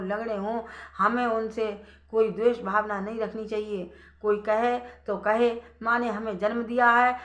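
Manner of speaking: loud, earnest preaching voice; storyteller preaching the moral to the audience, earnest and loud. Acted. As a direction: drive the lesson home to the listeners.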